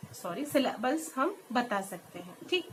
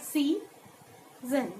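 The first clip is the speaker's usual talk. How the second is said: "Season" as a drawn-out sea, pause, zen; split into syllables; 3 dB quieter than usual. Shown as a demonstration. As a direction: say "Season" in two separate beats. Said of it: "How will we pronounce it?".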